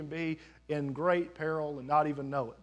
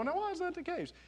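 high, soft mock-puzzled question; acted bafflement, pitched way up and softened; puzzled wondering; 12 semitones higher, 7 dB quieter than usual. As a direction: wonder aloud in a small high voice. Voices a wondering onlooker's question.

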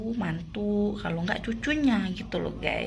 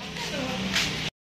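breathy laugh; 3 semitones higher than usual. Real, not acted. Laughing while ushering in.

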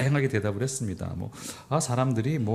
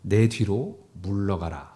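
slow solemn command; 2 semitones lower, much slower than usual. Retold or imitated. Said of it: "그러면서".

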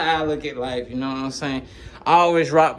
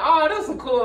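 laughing admiration; 10 semitones higher than usual. Retold or imitated. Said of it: "I'm like".

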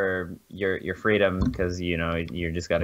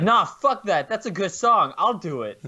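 high, louder cocky Kanye voice; [name] impression; 10 semitones higher, 3 dB louder than usual. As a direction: brag it like Kanye. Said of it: "he was like".

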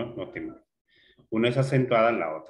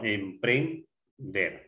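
"Emprender" slowly as em-pren-der; syllable by syllable; much slower than usual. Shown as a demonstration. Teacher separating the word for the class, syllable by syllable. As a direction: split "Emprender" slowly, pausing before the last syllable. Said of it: "Separar en sílabas".

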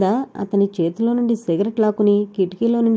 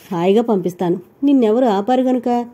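plain reading voice

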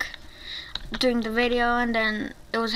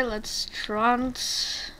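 dramatic transformation countdown; 2 semitones higher, much slower than usual. Pretend. Player about to transform into a demon, drawn-out countdown. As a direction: drag every word out to build suspense.

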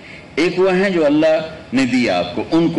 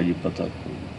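softer mock answer; 6 semitones lower, 11 dB quieter, faster than usual. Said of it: "کہا".

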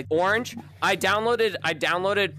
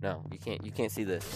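secretive hush, much softer; 9 semitones lower, 7 dB quieter than usual. Acted. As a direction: keep it low like a secret. Secretive aside to himself.

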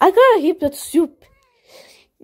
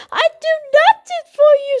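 high toy voice; 12 semitones higher, faster than usual. Pretend. Toy character argument.

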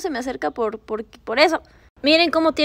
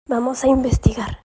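normal talking voice

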